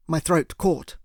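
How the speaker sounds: ordinary delivery, nothing marked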